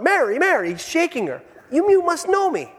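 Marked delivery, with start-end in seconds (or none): 0.0-0.7 high, panicked calling of a name; a man desperate to be recognized by his wife; freaking out. Retold or imitated. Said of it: "he's just freaking out". 1.7-2.7 high, desperate plea; begging his wife to recognize him; freaking out. Retold or imitated. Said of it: "he's just freaking out".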